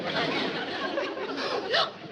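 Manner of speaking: sharp high gasp of delight; excited gasp. Acted. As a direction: gasp in thrilled surprise. Sees the princess again.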